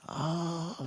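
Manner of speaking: high, held wail of pain; storyteller's drawn-out mock scream; acted scream. A narrator's reading. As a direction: wail "ah" high, without extra volume. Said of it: "could hear him screaming".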